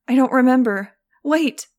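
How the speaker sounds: loud, high frightened cry; panicked plea. A narrator's reading. Director pleads with her captor not to drop her, frightened loud plea. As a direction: beg fast before she lets go. Captive about to be dropped.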